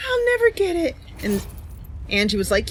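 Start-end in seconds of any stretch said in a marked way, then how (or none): 0.1-0.9 high, whiny "I'll never get it"; mock despairing whine. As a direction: whimper it like a hopeless beginner. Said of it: "I was like".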